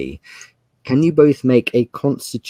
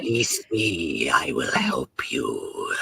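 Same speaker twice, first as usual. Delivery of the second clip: hissed, whispered creature bargaining; a trapped dragon tempting them with riches; whispery pleading dragon; 10 semitones higher, 4 dB quieter than usual. Acted.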